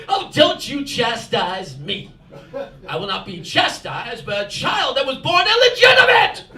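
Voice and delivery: put-on haughty English-accented mother, building to a shout; imitating mother's indignant English accent; angry English accent. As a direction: scold in a high mock English accent. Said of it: "she does it all in an English accent".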